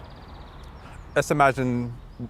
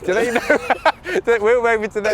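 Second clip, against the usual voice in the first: laughing question; 8 semitones higher, 4 dB louder than usual. Real, not acted. Laughing while asking.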